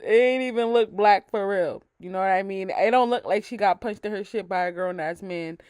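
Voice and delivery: ordinary delivery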